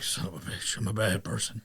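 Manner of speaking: whispered, low and defeated; guilty mutter. Retold or imitated. Carl sitting in grief, whispered low guilt. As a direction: breathe it out in guilty shame.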